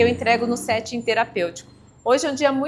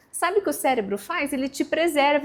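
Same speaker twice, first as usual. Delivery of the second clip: ordinary delivery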